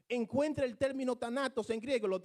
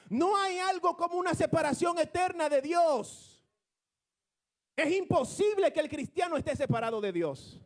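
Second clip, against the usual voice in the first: higher, louder preacher's proclamation; fervent preaching; 7 semitones higher, 4 dB louder than usual. Real, not acted. To the congregation about eternal separation, fervent preaching. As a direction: proclaim it to the church with rising fire. Preaching climax, rising fervor.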